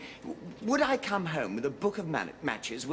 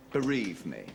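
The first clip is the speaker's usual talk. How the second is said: ordinary talk, nothing marked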